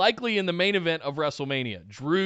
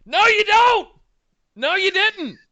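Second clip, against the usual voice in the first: higher, louder indignant No, you don't; exasperated retort; 13 semitones higher, 7 dB louder than usual. Real, not acted. Host angrily rebutting the company's claim that they listen, loud indignant retort. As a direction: shout down the empty promise.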